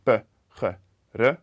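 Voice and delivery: "p, j, r" as separate Spanish sounds, not letter names; p, j, r as in pájaro, each sounded alone; isolated consonant sounds. Shown as a demonstration. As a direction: sound out p, j, r one by one, pausing between. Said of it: "the first consonant with the second".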